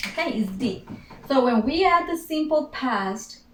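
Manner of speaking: ordinary delivery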